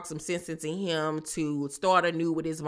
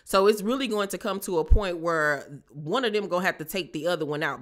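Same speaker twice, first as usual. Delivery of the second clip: normal talking voice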